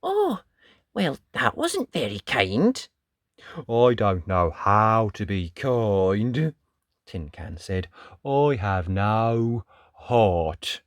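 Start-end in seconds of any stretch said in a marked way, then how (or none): 0.0-2.8 mild reproach. Acted. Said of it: "Little Dot frowned". 3.7-6.5 slow, deliberate robot plod; slow flat tin can. Acted. 8.2-10.8 slow, deliberate robot plod; slow flat tin can. Acted.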